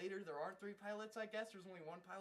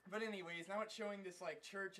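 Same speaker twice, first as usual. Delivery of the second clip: ordinary talk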